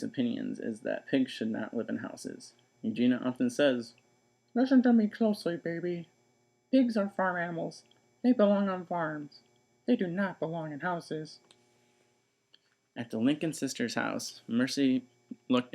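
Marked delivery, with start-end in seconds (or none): 4.5-11.4 put-on 1 bossy older sister. Eugenia voice.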